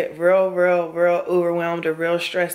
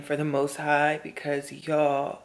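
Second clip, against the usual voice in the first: ordinary talk, nothing marked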